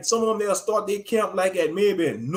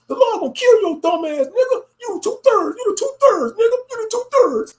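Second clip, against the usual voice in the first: much higher, loud, half-crying mock rant; mocking camp brother; 12 semitones higher, 3 dB louder than usual. Mocking a camp brother who screams at a leaving member, high and loud. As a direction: wail and shout the accusations. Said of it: "That's how they be sounding".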